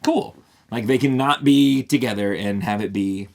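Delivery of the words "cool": oh cool. Retold or imitated. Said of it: "And so I was like".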